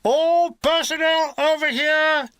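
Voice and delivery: high, loud drawn-out military call. A narrator's reading. Officer summoning all the men from a cave mouth, calling out. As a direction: holler it slowly across open ground. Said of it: "A voice is calling".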